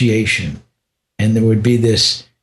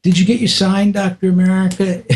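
much higher, playful mocking taunt; sing-song tease while tugging his beard; mocking guru; 8 semitones higher than usual. Guru teasing the crying visitor, high and mocking. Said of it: "started playing with my beard".

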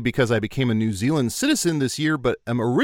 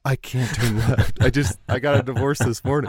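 depressed OnStar. Acted. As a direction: play a car assistant too miserable to give directions. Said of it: "depressed OnStar".

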